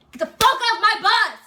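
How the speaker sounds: loud, high, drawn-out bellowed order; sergeant's yell. Retold or imitated. Sergeant repeats order to recruits who didn't hear, yelled. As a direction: imitate a drill sergeant exploding at full volume. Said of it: "Oh, you didn't hear me?".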